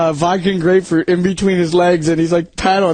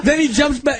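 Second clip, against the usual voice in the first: normal talking voice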